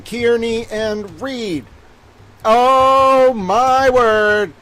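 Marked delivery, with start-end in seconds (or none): 2.4-4.5 very slow, stretched mock dismay; hammed-up "oh my word"; drawn-out exasperation. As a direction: let it sag out in disbelief. Exasperated at news.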